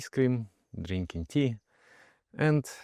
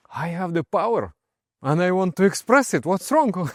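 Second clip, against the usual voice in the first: exuberant retort; 8 semitones higher, 5 dB louder than usual. Retold or imitated. Overjoyed friend's answer retold.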